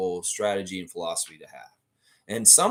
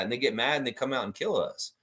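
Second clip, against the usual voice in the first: ordinary talk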